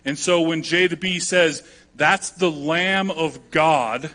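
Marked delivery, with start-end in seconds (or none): 2.0-4.1 slow weighty proclamation. Shown as a demonstration. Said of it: "that's a big statement".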